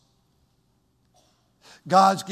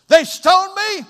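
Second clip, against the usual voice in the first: excited; 11 dB louder, slower than usual. Retold or imitated. Acted martyr quote, cheerful.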